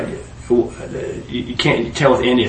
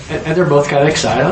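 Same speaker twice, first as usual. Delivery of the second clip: ordinary delivery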